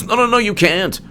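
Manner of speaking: lightly mocking the media's panicked no; mock whiny objection. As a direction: whine it like a spoiled critic.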